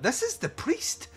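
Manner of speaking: high-pitched comic disbelief; incredulous. Real, not acted. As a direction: question it in a high, baffled voice. Mock disbelief at the priest.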